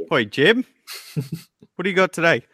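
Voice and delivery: put-on a boss hollering at the R and D guy in a terrible Aussie accent; bad Australian accent. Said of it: "That was a terrible Australian accent".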